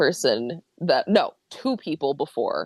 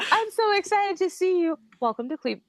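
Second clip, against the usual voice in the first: high, bubbly gushing welcome; excited greeting; 13 semitones higher than usual. Retold or imitated. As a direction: gush it out like a fan. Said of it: "You could say".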